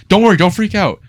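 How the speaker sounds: high, loud, frantic-sounding reassurance; mock alarmed reassurance. First-aider when the light goes off, mock alarm. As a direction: imitate someone urgently calming a patient.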